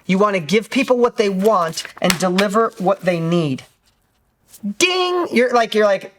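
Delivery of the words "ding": mock bell ding. Bell sound marking a point.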